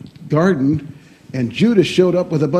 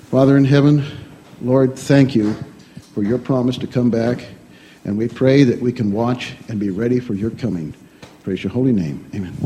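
prayer; 6 semitones lower than usual. Acted. Pastor closing the children's sermon in prayer, low and reverent. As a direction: lead the children in a hushed prayer.

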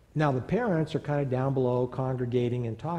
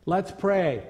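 put-on high, louder voice of a ten-year-old boy; a small boy taking charge, loud and sure; ten-year-old boy telling the big boys to pray, high and confident; 9 semitones higher, 5 dB louder than usual. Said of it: "he said to them".

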